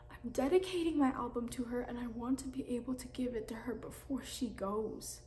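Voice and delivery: soft, hurt, near whisper; quiet pleading. Acted. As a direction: plead with her, barely voiced. Teen breaks down pleading to mom.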